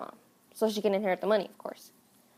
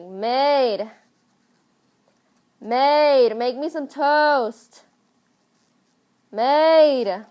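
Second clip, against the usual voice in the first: calling out; 4 semitones higher, 3 dB louder, much slower than usual. Acted. Calling the maid.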